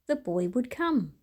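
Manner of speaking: usual speaking voice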